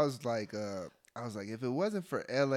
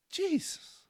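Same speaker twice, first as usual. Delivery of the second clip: shocked. Real, not acted. Shocked at LA rent prices.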